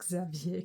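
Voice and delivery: "Xavier" said the French way, zav-YAY; French-style "Xavier", ending in a stressed "yay"; French pronunciation. Real, not acted. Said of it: "said it with the French pronunciation".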